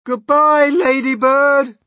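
high, loud, drawn-out cry; desperate gasped farewell. A narrator's reading. James clinging to the falling peach, desperate farewell cry. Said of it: "gasped James".

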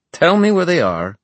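loud, high-pitched ultimatum; menacing demand. Acted. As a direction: force the answer out of them. Villain threatens hostage's wife.

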